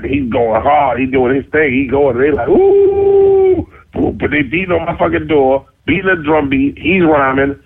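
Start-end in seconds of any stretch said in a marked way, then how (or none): 2.5-3.7 very high, drawn-out "ooh"; crowd whoop. Retold or imitated. Friends in the hall hyping the rapper, high whoop. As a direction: imitate an impressed crowd.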